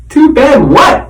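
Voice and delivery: a nervous mouse squeaking a question. A narrator's reading. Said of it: "I squeaked".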